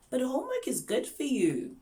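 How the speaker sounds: high, singsong encouragement; coaxing teacher. Acted. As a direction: coax a reluctant child brightly. Teacher coaxing a reluctant student.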